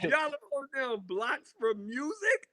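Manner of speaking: high, laughing disbelief at selling rules; incredulous. Retold or imitated. To the crew claiming the blocks, high laughing disbelief. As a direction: say it like you can't believe it, half laughing.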